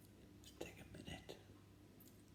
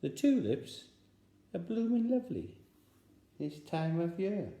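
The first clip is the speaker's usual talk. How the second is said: much higher, loud sing-song code; spy code phrase; 9 semitones higher, 4 dB louder than usual. Acted. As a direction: give the password with meaning.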